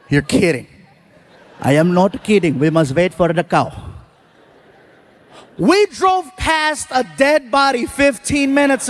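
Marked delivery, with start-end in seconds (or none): 1.6-3.7 put-on low Indian accent, unhurried; lowered Indian accent, matter-of-fact; Indian driver. Driver voiced in story.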